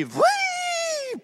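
a quick high whee; whistling whee. Retold or imitated. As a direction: make the bike's whoosh with your voice. Bike flies toward tree.

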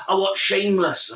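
high-pitched Yorkshire caricature; mock northern accent. Mocking northern TV viewers, caricatured northern accent. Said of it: "shall I attempt to do a northern accent?".

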